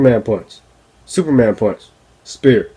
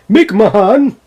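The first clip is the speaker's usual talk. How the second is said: raised, pushed-out voice doing a wrestling boss; bad Kevin Dunn impression; 6 semitones higher than usual. Vlogger mocking a WWE executive, bad impression. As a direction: ham up a boss's voice, badly on purpose. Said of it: "That's a bad Kevin Dunn impression".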